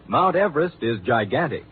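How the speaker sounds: usual speaking voice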